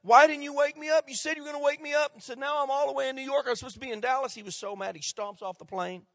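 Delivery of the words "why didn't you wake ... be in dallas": loud, high angry outburst at the flight attendant; irate traveller raising his voice; furious passenger. Retold or imitated. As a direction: yell it, fed up and betrayed. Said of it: "and he said".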